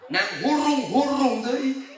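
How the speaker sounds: high, slow cooing lisp; lisping baby talk. Retold or imitated. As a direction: coo like a mother to a baby. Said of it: "Ngomong dicadel-cadel".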